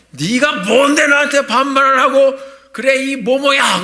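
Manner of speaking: loud, high, furious yelling; angry yell. Retold or imitated. Young man yelling at the rude clerk, angry shout. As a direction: tear into the clerk for talking down. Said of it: "갑자기 큰 소리로 그러는 거예요".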